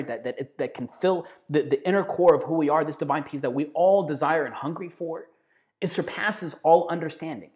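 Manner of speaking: ordinary delivery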